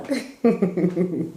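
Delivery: short chuckle to herself; amused falling laugh. Real, not acted. Author amused by her own series title, chuckling.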